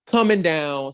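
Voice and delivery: ordinary talk, nothing marked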